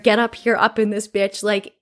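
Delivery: ordinary talk, nothing marked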